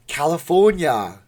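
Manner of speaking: a half-managed Austrian strongman voice; Arnold. Shown as a demonstration. Streamer teaching viewers Arnold, faltering impression. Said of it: "see, I can't do it".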